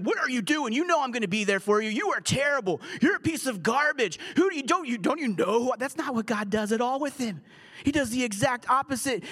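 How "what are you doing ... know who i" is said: mock scolding lecture. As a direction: play an exasperated God telling someone off, hammed up. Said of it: "lecture him".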